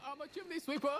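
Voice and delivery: high, laughing cockney chimney sweep. Acted. Told to think chimney sweep, a high laughing cockney voice. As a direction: play a cheerful Victorian sweep. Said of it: "Think chimney sweep".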